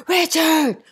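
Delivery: shouted high and loud across the river; urgent loud call for help. A narrator's reading. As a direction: yell his name to get him running. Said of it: "I call out".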